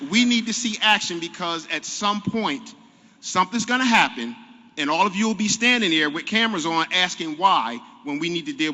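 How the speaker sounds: loud, frustrated plea. Real, not acted. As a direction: push it out with exasperation. Frustrated demand for action.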